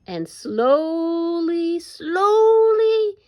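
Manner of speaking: very slow, stretched words, rising on the repeat; drawn out slowly. A narrator's reading. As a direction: stretch each "slowly" to match the walk. Narration acts out slow walking.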